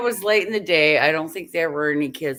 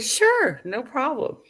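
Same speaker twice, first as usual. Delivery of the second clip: bright, much higher, breezy innocence; mock innocent; 8 semitones higher, slower than usual. Driver sweetly reassuring the cop, mock innocent. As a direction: play it all-innocent for the officer. Acting innocent at sobriety stop.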